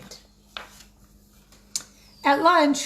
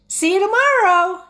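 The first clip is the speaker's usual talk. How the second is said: high, loud taunting singsong; teasing; 9 semitones higher, 8 dB louder than usual. Acted. Wendell teasing Sophie with flashlight, loud high taunt. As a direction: taunt her with a bright high goodnight.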